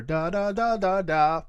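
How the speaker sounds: da syllables with added pitch; pitched vocal effect. Shown as a demonstration. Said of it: "I'll go into a pitch".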